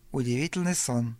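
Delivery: ordinary delivery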